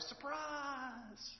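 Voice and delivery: high, excited friends' surprise call; mock surprise shout. Friends greeting the kid, excited surprise. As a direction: sing out a bright surprise.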